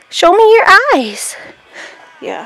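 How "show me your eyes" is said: high, louder sing-song coaxing. Real, not acted. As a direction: coo at the goat to look up. Coaxing the goat.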